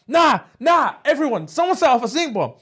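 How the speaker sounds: high-pitched mock outcry; retold scream. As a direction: imitate her calling out to the whole class. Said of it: "and she screams".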